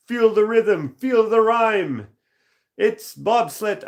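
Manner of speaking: high, loud, slow chant of the film line; movie quote voice. Retold or imitated. Streamer quoting Cool Runnings to chat, high loud chant. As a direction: belt it out like the bobsled team.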